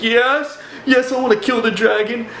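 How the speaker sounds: high, strained mock-frightened yes; high-pitched desperate answer, imitated; imitated shaking fear. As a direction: act out a terrified man agreeing. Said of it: "totally shaking at this time".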